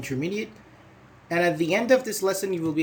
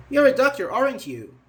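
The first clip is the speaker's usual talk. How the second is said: ordinary delivery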